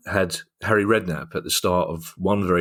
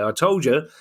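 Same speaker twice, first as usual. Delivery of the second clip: higher-pitched triumphant announcement; gloating fan; 7 semitones higher than usual. Acted. Fan rushing in to tell their partner, gloating. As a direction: crow it as an imagined vindicated fan.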